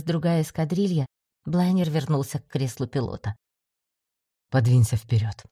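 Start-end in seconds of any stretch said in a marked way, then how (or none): 4.5-5.5 low hoarse command; hoarse order. A narrator's reading. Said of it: "хрипло приказал он".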